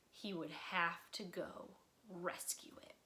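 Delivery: whispered resolve; quietly steel yourself; hushed determination; determined whisper. A narrator's reading. Resolving to rescue bear.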